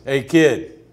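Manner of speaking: loud, booming call across the room; old slugger calling the kid over, loud and booming. Retold or imitated. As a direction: boom it out like a legend summoning a rookie. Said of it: "I hear a big boom of voice".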